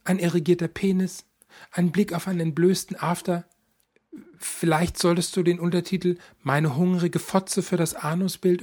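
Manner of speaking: a mother cheerfully discussing her son's photos; chatty mother. Acted. Mother's long speech.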